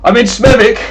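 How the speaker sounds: imitating an angry Birmingham gamer; angry Xbox chatter. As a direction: do the raging Xbox live kid. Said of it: "I'm an angry Xbox live chatter".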